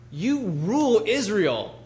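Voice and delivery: high, loud, drawn-out mockery; mocking. Wife taunts her sulking king husband, mocking. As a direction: imitate a scornful wife, stretching each word in a swooping high pitch. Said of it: "the mocking in her voice".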